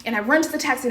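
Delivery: ordinary delivery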